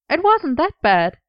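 high, amused protest. Acted. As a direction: argue it with a smile. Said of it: "argued with an amused smile".

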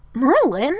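aghast rising query; scandalized. A narrator's reading. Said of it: "he sounded rather scandalized".

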